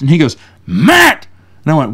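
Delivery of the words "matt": high, sharp imitation of an angry man; pissed-off yell. Pissed speaker coming out of the bathroom, mock angry yell. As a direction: call him out, furious, as a retelling. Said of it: "and he is pissed".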